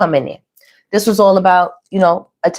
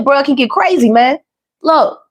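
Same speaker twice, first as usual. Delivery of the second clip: higher-pitched put-on [name] accent; [name]'s accent; 6 semitones higher than usual. Reader quoting [name] to viewers, playful accent impression. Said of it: "I don't even know where this accent is coming from".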